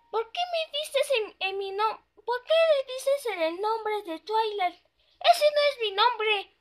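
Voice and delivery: high, indignant protest; high pony voice insisting that's not her name. Acted. The pony protests to her friend about her name, high and indignant.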